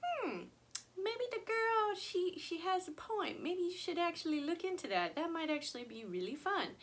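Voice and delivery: high-pitched pondering, easing down gradually; musing to herself. Retold or imitated. Vlogger replaying her reaction to a subscriber's comment, musing. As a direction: act out a thought dawning, pitched well up. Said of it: "I was like".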